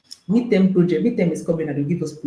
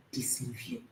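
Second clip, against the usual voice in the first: usual speaking voice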